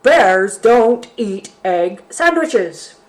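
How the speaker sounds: slow, loud bear voice; loud bear refrain. Acted. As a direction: hammer out each word. Bear's angry refrain.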